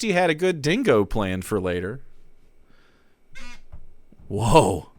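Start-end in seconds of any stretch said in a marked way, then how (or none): none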